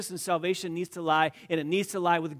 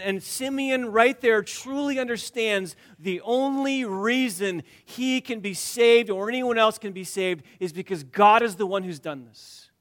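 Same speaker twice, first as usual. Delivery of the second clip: loud, high preaching climax; preaching at full force; 6 semitones higher, 6 dB louder than usual. Acted. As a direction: build the sermon to a ringing peak. Preacher building up.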